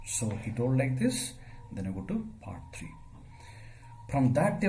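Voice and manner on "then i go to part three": whispered aside. Real, not acted. Teacher's aside before next part.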